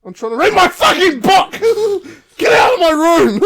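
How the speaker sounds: loud, high angry shout; hammed-up yell at the intruders; mock furious mum. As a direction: mum yelling at son and dogs to get out. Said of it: "Mum's going".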